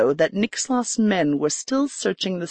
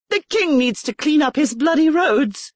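much higher, indignant outburst; exclaiming; 9 semitones higher, 3 dB louder than usual. A narrator's reading. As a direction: blurt it out in indignation. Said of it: "she exclaimed".

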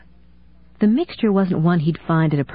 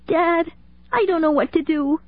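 put-on much higher child's voice, scared and pleading; a little girl begging her dad to come; frightened young daughter; 12 semitones higher than usual. Daughter pleading with dad over phone, high and scared.

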